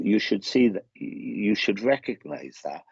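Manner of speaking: usual speaking voice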